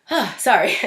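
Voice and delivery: catching her breath through tears; real tears, apologizing; choked up. Real, not acted. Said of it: "Getting a little emotional".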